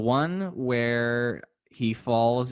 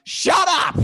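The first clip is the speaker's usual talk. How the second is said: high, loud angry yell; angry shout; 10 dB louder than usual. Retold or imitated. Neighbor woken at night yelling at the singer, furious. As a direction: yell at the noisy singer to shut up. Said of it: "voice going".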